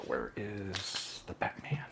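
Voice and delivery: slow whisper to himself. Real, not acted. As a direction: breathe the question slowly. Aside while searching around.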